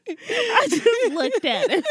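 high, loud, slow laughing line; cracking up while telling it. Real, not acted. Retelling the nephew story, cracking up.

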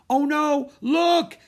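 loud, high frightened shriek. A narrator's reading. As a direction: shriek in terror. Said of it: "shrieked Annie".

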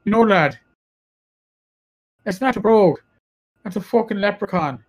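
lightly imitated Irish bartender's brogue. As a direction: scold in a hint of an Irish brogue. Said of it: "slip into my Irish brogue".

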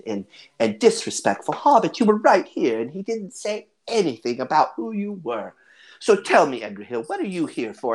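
emphatic granny scolding, then turning to question a guest; huffy old lady with swooping pitch; disapproving hobbit grandmother. Acted. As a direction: lecture like an affronted grandma.

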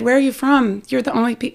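imitating an Australian, not very well; attempted Australian accent. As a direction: have a shaky go at an Australian accent, then give up. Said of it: "I can't do Australian".

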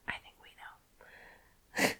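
slow conspiratorial whisper. Real, not acted. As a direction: whisper it like a shared secret. Knowing aside to listeners.

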